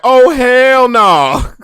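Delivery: high, loud, very slow oh hell no; loud disbelief. Retold or imitated. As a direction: stretch out the refusal. Sees the huge opponent up close.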